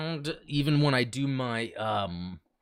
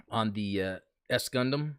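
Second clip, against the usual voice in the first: ordinary talk, nothing marked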